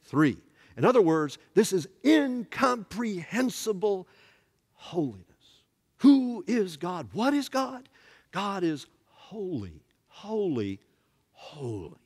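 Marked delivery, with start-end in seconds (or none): 1.5-12.0 slow, high, hushed wonder fading on the last holy; awed preaching. Acted. Preacher ending on God's holiness, slow and awed. Climax of the sermon.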